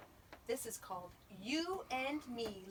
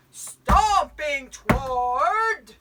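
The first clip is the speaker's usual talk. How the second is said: very loud, drawn-out, higher words; heavy stomping narration; 5 semitones higher, 21 dB louder, much slower than usual. A narrator's reading. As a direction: stomp through each word slowly.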